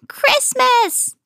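high, drawn-out troll wail. A narrator's reading. Trolls begging for Christmas, high wail. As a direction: wail it like a needy little troll. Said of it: "they wailed".

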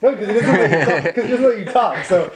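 laughing while teasing; words bubbling with laughter. Real, not acted.

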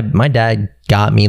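usual speaking voice

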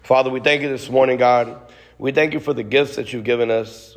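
usual speaking voice

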